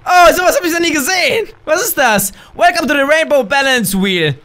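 usual speaking voice

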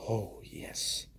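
breathy whispered yes; whispered delight. Real, not acted. Pleased at a pulled card, whispering.

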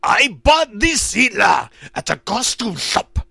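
put-on high, harsh German-accented shout; screaming. Hitler-sounding man explains his costume, screaming. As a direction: shout it full force like a ranting dictator.

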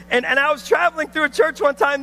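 ordinary delivery

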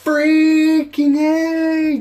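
high, loud, very slow stretched yell; real furious yell. Real, not acted. Gamer showing how he sounds when pissed, real furious yell. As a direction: drag the whole yell out in rage. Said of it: "what I am like when I'm pissed off".

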